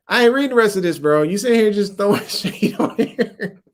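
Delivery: high, loud laughing protest; mock outrage. Real, not acted. Host refuses a troll's shade-throwing question, mock outrage. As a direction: balk loudly, cracking up by the end.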